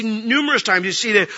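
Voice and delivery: usual speaking voice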